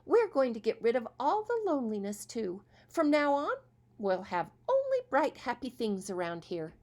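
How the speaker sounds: rally the family with sunny cheer; cheery stepmother. Acted. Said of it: "she said in a cheery way".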